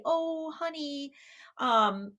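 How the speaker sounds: put-on a parent comforting a crying child; gentle sing-song comfort; cooing parent. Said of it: "another kind of response".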